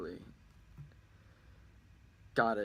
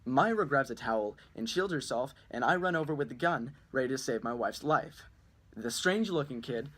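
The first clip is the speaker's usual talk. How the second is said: normal talking voice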